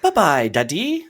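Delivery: put-on high, slow toddler voice in a British accent; mock British toddler. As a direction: imitate a little kid's British accent. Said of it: "watching too much British television".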